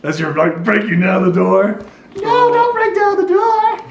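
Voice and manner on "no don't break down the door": high, slow, sweet little halfling plea; cute halfling voice. Acted. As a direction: coax them to stay, tiny and sweet. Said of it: "This cute little halfling voice is like".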